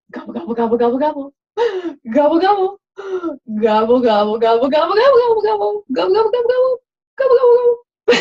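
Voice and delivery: high loud gobbling, rising as it goes; playful turkey gobble. Pretend. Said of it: "we can pretend that we're turkeys".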